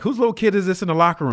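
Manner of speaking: high, loud, quick taunt; imitating a big veteran sizing up a newcomer; mocking veteran teammate. Teammate mocks the small rookie.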